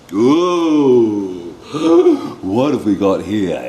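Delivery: loud gasp, hammed-up wonder; mock surprise. The teacher shows the class a new picture, hammed-up gasp. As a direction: act amazed for the children.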